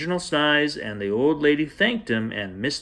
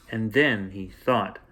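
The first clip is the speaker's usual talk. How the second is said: plain reading voice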